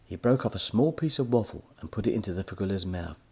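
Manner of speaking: ordinary delivery, nothing marked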